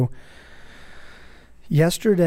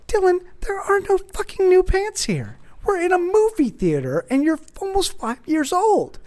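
exasperated outburst. Retold or imitated. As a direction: explode in disbelief at the kid. Dad exasperated at son.

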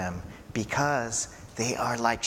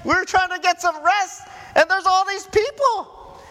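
much higher, louder, squeaky mock complaint; mock whiny disciples; 7 dB louder than usual. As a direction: play the worn-out disciples, whining in falsetto.

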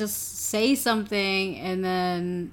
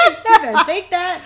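teasing callout; 7 semitones higher, 8 dB louder, much faster than usual. Retold or imitated. Teasing retold taunt.

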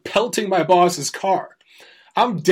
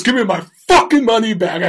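retold furious demand, louder and higher; angry demand; 6 semitones higher, 5 dB louder than usual. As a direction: bark it like a cheated customer. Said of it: "He was so pissed".

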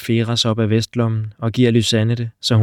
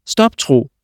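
louder, higher cry of his name; shouted call; 4 semitones higher, 5 dB louder than usual. Acted. Lysanne calls out to stop Tro, shouting. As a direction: call out to halt him. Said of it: "råber en stemme".

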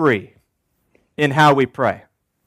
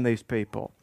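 ordinary delivery

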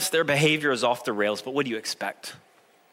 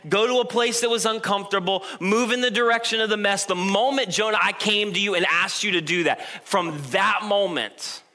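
higher and building, a preacher's rebuke; pleading God; 6 semitones higher than usual. Acted. God pressing Jonah about his comfort, raised and insistent. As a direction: lay it out like an exasperated father.

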